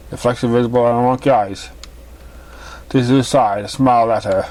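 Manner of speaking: usual speaking voice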